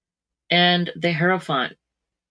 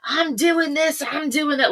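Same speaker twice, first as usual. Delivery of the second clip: high-pitched mock blabbermouth; blabbering; 9 semitones higher, slower than usual. Reader mimicking what Aquarius would not say, high blabbering. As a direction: imitate someone announcing every move, high-pitched. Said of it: "you don't blabber everything".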